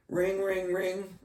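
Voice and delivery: high-pitched sing-out "ring, ring, ring"; imitated phone ring. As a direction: mimic a ringing telephone with your voice.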